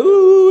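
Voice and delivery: loud mock crying wail; imitated child's wail. Father imitating his crying child, long loud mock wail. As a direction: let out a drawn-out pretend sob. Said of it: "nangis gitu kan".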